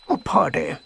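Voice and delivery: soft high bear voice; lightly voiced high honey-loving bear; Pooh voice. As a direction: play Pooh, gentle and dreamy.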